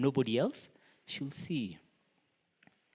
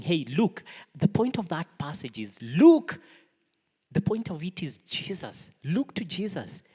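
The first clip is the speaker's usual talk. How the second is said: urgent; 7 semitones higher, 6 dB louder than usual. Real, not acted. Urging congregation to look.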